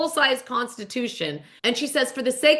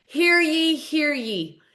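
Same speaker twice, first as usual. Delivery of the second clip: higher, sing-out mock proclamation; town crier; 5 semitones higher, much slower than usual. [name] calls court into session, proclaiming like a crier. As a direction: call court to order, first call high. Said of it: "calls court into session".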